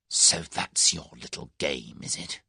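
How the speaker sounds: slow, menacing hissed threat; savage hiss. A narrator's reading. Whittington, enraged, hisses at Tuppence with savage menace. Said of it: "hissed savagely".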